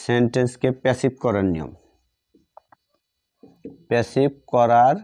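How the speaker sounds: ordinary talk, nothing marked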